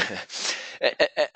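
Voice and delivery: short breathy laugh at the market; real laugh. Real, not acted. Host laughing about market distractions, short real laugh. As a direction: laughs softly, amused by the daily chaos. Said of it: "and I'm laughing because".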